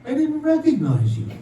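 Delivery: high-pitched, lightly acted excuse; God's punchline excuse. God looks at the dead woman, excusing himself. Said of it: "He looks at her and says".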